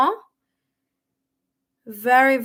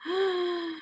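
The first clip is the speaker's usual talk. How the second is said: soft gasp; 7 semitones higher, 8 dB quieter than usual. Real, not acted. Reacting to a confirmed detail.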